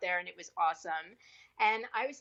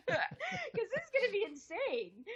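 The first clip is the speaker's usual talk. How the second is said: high, slow, laughing through the words; 12 semitones higher than usual. Real, not acted. Actress recalling the nude body-paint offer, laughing. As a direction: talk through a real laugh.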